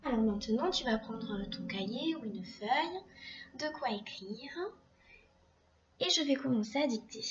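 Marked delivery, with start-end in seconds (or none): 0.7-4.5 whisper. Real, not acted. Teacher aside to pupil.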